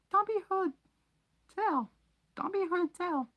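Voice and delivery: very high squeaky elf voice, loud; high elf voice, hoarse and urgent; hoarse house-elf. Acted. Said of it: "he said hoarsely".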